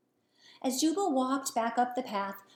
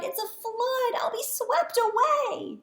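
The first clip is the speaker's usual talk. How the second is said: high, panicky bullfrog; frightened; 11 semitones higher than usual. Acted. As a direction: fret as the water rises. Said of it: "he cried".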